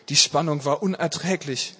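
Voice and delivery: usual speaking voice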